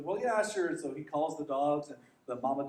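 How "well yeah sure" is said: higher, louder casual farmer; shrugging farmer giving in; lightly voiced farmer; farmer agreeing. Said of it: "so he says".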